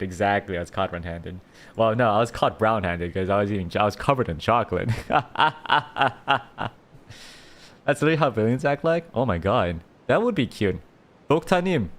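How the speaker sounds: ordinary talk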